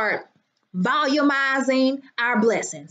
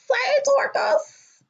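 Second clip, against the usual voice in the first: "Hey, Dorcas" lifted far higher and slow, sing-song; high sing-song greeting; 12 semitones higher, much slower than usual. Real, not acted. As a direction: float the repeat of "Hey, Dorcas" way up, slowly. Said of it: "repeats the greeting much higher".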